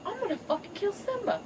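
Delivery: high, mock-villainous threat; high hammed-up lioness vow of revenge; vengeful mama. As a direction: spit out the threat like a cartoon villainess. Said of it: "She's like".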